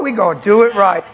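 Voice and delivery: pumped up and grinning; excited. Real, not acted. Pastor at the pond telling the kids, excited and loud.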